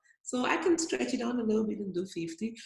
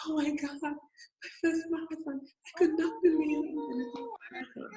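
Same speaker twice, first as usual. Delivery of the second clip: awed disbelief; 8 semitones higher than usual. Retold or imitated. Awe at finishing marathon.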